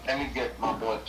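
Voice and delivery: usual speaking voice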